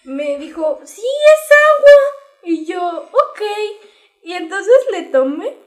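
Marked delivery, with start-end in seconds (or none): 0.8-1.9 much higher and louder fake-sweet reply; sister's mocking lie. Hermana miente sobre el agua. 3.1-3.4 put-on high, louder little-girl ok; innocent kid. Ella misma de niña inocente.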